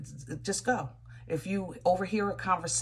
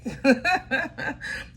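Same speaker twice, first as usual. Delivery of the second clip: laugh; 6 semitones higher, 4 dB louder than usual. Real, not acted. Laughs mid explanation.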